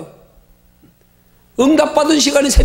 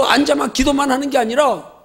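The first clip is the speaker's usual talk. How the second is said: usual speaking voice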